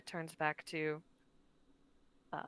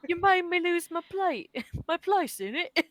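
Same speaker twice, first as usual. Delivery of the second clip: laughing Cockney imitation; 9 semitones higher, 7 dB louder than usual. Mimicking the Cockney line, laughing.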